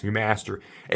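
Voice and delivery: ordinary delivery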